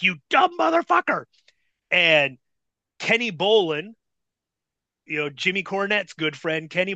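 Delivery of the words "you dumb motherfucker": high-pitched mock scream of abuse; retold screaming. Bull screaming at the clueless rookie, high mock yell. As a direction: insult him in exasperation, imitating a scream. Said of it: "screaming at me".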